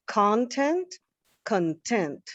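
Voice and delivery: "Content" as CONtent, rising; stress on first syllable. Shown as a demonstration. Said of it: "stressing a different syllable".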